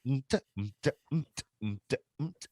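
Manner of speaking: vocalized oom-pah beat; polka beat imitation. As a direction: sing the polka rhythm. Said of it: "polka is usually like".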